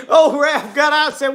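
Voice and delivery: ordinary delivery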